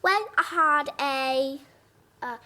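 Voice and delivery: slow, badly faked British accent; British attempt sliding into southern twang; best British attempt. Shown as a demonstration. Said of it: "I'll try it in my best British".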